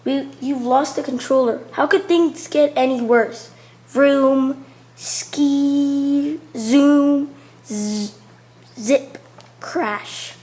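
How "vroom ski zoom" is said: playful engine sound words; vroom sound effects. Acted. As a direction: make the car zoom past. Sound effects read aloud.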